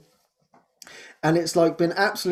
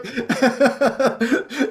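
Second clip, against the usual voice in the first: high giggle; 8 semitones higher than usual. Real, not acted. Still laughing.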